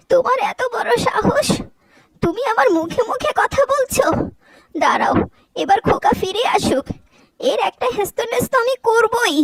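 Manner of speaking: high-pitched indignant scolding; angry scolding. Acted. Mother-in-law scolding her daughter-in-law for talking back, high and indignant. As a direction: put the insolent bride in her place.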